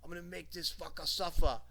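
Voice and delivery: hammed-up British accent threat. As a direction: imitate a menacing British wrestler. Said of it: "with his British accent, he goes".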